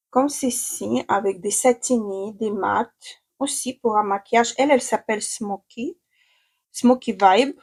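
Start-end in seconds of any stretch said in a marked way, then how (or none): none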